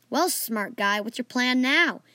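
plain reading voice

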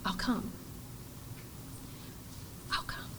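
very soft tender reply; gentle assurance. Retold or imitated. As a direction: soften to a near whisper. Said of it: "she looks up to him and says".

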